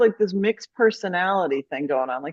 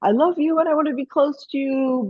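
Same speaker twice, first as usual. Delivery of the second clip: higher, sweet talking dog; a clingy dog pleading, pitched up; animal voice; 8 semitones higher than usual. Acted. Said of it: "that's my animal voice".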